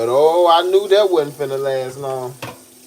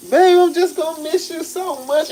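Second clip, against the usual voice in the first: high, mock-sweet girlfriend voice; put-on high girly pleading; imitating girlfriend; 12 semitones higher than usual. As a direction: impersonate a girlfriend getting sappy, pitched way up. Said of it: "And she like".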